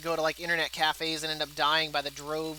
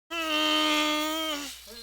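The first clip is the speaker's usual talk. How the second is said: whiny mock groan; 8 semitones higher than usual. Mock disappointment.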